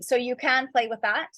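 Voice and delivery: ordinary talk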